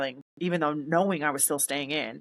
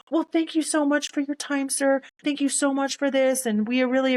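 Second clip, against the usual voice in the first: high, monotone imitation of a polite official; imitated brush-off; 8 semitones higher than usual. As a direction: talk over them in a higher, even polite voice. Official cutting the speaker off.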